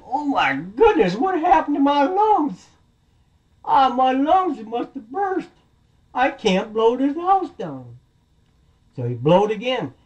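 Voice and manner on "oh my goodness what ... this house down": high, slow comic wailing; dismayed big bad wolf. Wolf who can't blow the house down, high wailing dismay. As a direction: lament it with drawn-out cartoon alarm.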